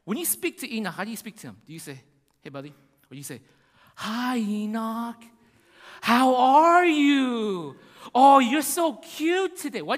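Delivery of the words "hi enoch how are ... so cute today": put-on drawn-out, high, gushing baby talk; talking to a child. Shown as a demonstration. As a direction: drag out the notes loudly as if cooing at a toddler. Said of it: "drag on your notes when you talk to a child".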